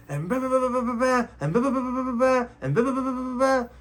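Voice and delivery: high-pitched run of babbled blahs; mock venting. Wife venting until she runs out of steam, mock babbling. Mimics a woman venting at length.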